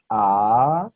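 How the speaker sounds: long, loud rising "A"; second tone. Shown as a demonstration. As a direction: draw out "A" as it climbs like a question. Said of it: "El segundo tono".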